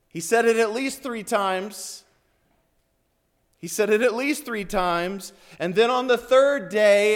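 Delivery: high, forceful sermon delivery; impassioned preaching. Real, not acted. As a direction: preach it out with urgent emphasis.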